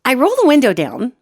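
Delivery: normal talking voice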